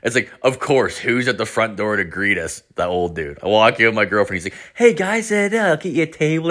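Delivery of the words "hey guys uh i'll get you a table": high, sing-song fake-friendly owner voice; a lightly voiced cheery restaurant host; mock polite host. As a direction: play the old owner all sweet and welcoming. Old owner greeting them politely.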